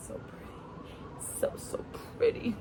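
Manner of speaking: breathy, slow whispered praise. Acted. Lover whispers praise to her, slow hushed whisper. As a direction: whisper it, drawn out and admiring.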